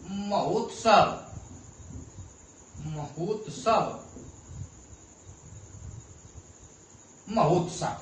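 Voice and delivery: महोत्सव said slowly, leaning on the ओ in हो; stress on the o vowel. Shown as a demonstration. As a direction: draw out महोत्सव and weigh the ओ sound each time. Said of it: "ओ की मात्रा पर बलाघात हो रहा है". (महोत्सव धीरे से, ओ की मात्रा पर ज़ोर देकर)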